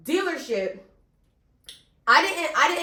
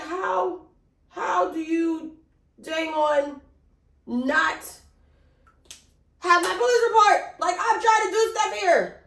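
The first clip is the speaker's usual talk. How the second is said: pissed off; 6 semitones higher, slower than usual. Retold or imitated. Woman confronting police over her missing report, pissed off. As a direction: stretch out each word, then burst out higher. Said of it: "I was so pissed".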